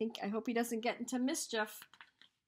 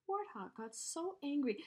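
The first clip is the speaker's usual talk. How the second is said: usual speaking voice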